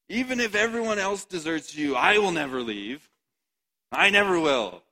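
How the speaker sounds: loud, high, insistent vow; Peter's fervent vow. Acted. Peter vowing loudly to Jesus, fervent high cry. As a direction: swear it with everything, voice up high. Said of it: "Peter said to him".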